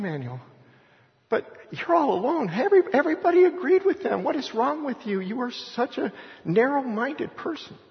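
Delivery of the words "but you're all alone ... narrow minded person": high, scornful taunt at the loner; mocking crowd voice. Crowd taunting the lone believer, high and scornful. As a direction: sneer at him for standing apart.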